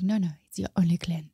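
put-on hushed, whispery French accent; soft put-on French accent. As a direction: imitate a French friend, softly and breathily. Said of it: "she's got a French accent".

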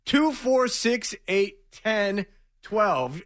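tally one number at a time, spaced out; counting out. Real, not acted. Host adding up the guests for cohost, counting slowly. Counting heads to total the bill.

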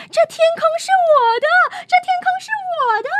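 very high, loud and fast scream; screaming frog. Acted. Julia leaps at the butterfly and claims the sky, screaming. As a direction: shriek it out while leaping at a butterfly. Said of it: "尖叫着跳起来扑向蝴蝶".

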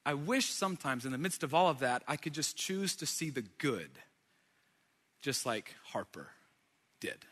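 soft, subdued, wistful thought; reflective wish. Retold or imitated. Retold reflective thought while taking picture.

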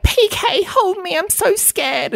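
very high, hammed-up whimpering plea; over-the-top scared voice begging her husband; mock frightened Dorit. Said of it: "And she's like".

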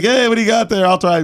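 high, eager voice asking for a taste; excited greeting. Retold or imitated. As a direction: bounce up to each booth, eager for a free sample. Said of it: "And I was like".

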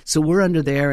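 ordinary talk, nothing marked

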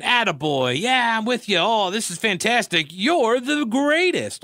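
high-pitched mock gushing, stretching the last words; gushing flattery. Afghan leader buttering up the American president, mock gushing. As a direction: lay on hammed-up backslapping praise. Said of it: "buttering him up".

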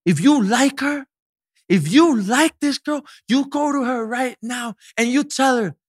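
put-on high, slow, pushy drunk friend in English; aggressive drunk friend. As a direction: bark the dare at him like a tipsy friend. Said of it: "Ella me lo dice agresiva".

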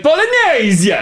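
"Bolognese!" belted high, Italian style; mock Italian shout. Co-host joking about yelling bolognese at Italian dinner. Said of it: "I'm just shouting Italian at a random person".

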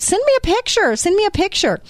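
very high, eager plea; excited demand. Retold or imitated. Speaker retelling her plea to her friend for a puppy photo, excited demand. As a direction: replay an eager demand, pitch shooting up.

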